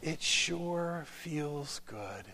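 very slow, soft and gentle; Mister Rogers gentle voice. Retold or imitated. Inviting the officer to join him in the pool, slow gentle voice. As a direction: invite him in kindly, unhurried. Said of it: "in this wonderful, gentle voice".

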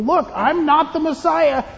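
high, loud declaration; emphatic proclamation. Acted. Telling other people who he is not, high loud declaration. Voicing what listeners tell others.

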